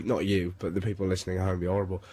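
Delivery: ordinary delivery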